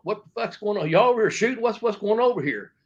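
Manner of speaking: high-pitched mock confrontation; retold indignant questioning. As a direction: act out butting in, demanding answers. Acts out two men butting in.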